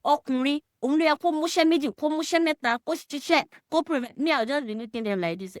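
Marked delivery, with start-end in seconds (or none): 0.0-4.2 high, fast mocking quote of gossiping women; women mocked with a high, rushed chatter; mocking women's chatter. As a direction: rattle it off high and quick, mocking them. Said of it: "say that".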